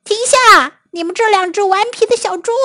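high, fast scolding shout; angry yell. A narrator's reading. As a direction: call out sharply, then rush the scolding. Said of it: "芬斯特先生大叫".

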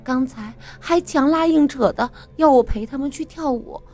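a hint of tearful hurt; sobbing complaint. Girl tells boyfriend about the bullies, mildly tearful. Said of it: "抽抽搭搭一阵之后".

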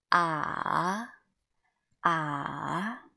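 long "Ah" falling, then climbing back up; slow down-then-up "Ah"; third tone. Shown as a demonstration. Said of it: "this is a third tone".

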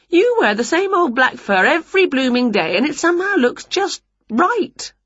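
high-pitched, lilting storybook witch; envious Winnie. Acted. Winnie telling her cat his fur always looks right, envious. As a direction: lift it high, wistfully admiring.

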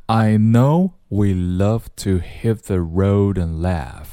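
slow, measured line reading; unhurried demonstration for learners; slow read-through. Shown as a demonstration. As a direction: read the rap line out slowly. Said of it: "我们先读一读啊".

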